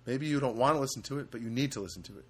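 ordinary talk

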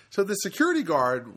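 usual speaking voice

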